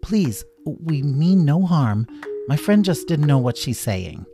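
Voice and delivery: pleading. Acted. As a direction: beg them gently. Bunny pleading.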